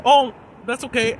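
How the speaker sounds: sweetly defiant, a sing-song no thanks; fake sweet refusal. [name] voiced defying boss.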